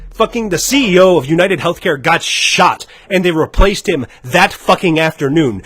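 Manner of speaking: ordinary delivery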